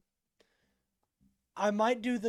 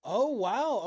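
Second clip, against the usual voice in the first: higher, louder amazed oh wow; surprised; 5 semitones higher, 6 dB louder than usual. Real, not acted. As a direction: genuinely caught off guard. Discovers meaning online.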